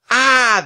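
high, loud, drawn-out scream; terrified scream. A narrator's reading. All three finding the bone outside the door, terrified scream. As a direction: scream in shock. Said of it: "they all screamed".

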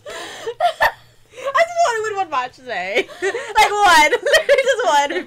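high, loud laugh-crying complaint; laughing lament. Real, not acted. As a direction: whine through laughter about one match. Lamenting lost match, laughing.

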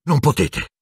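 high, urgent protest; out of breath. A narrator's reading. Harry blocking the wands, breathless protest. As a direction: plead it on short breath. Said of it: "disse col fiato mozzo".

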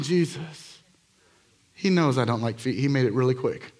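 ordinary delivery

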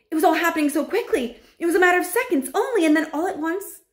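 fast, high, loud narration; excited rush. A narrator's reading. Narrator watching a boy turn into a mouse, breathless excitement. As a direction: race through it with mounting excitement. Narrator races through sudden transformation.

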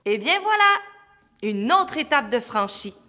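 loud, high cheer of triumph; triumphant. Real, not acted. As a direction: announce it with a big flourish. Triumphant after tongue twister.

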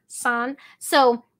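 ordinary talk, nothing marked

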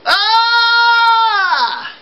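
long piercing high shriek; ghoul scream. Acted. Ghoul shrieking to scare the people, loud and high.

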